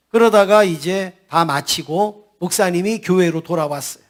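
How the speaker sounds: usual speaking voice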